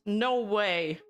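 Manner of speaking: normal talking voice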